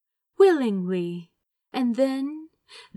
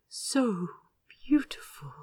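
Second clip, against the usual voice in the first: soft awe; 3 semitones higher, 3 dB quieter than usual. Acted. Continues the awed whisper.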